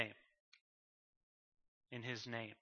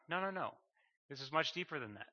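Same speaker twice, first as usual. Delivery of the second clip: ordinary delivery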